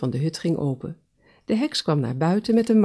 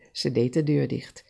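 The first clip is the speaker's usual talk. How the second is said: usual speaking voice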